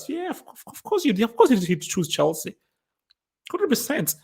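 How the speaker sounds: high, excited disbelief; can't believe anyone would doubt it; incredulous. Real, not acted. Arguing with fans, high incredulous disbelief. Incredulous about Sancho choice.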